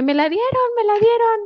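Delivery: high, rushed, bubbling over; excited news. Retold or imitated. Telling mom and sister about the visa, high and excited. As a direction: blurt it out to your family. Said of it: "le dije".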